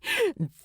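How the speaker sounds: a short high chuckle; Bilbo's light laugh. Acted. Bilbo laughs fondly at Thorin in the market. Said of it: "Bilbo had laughed".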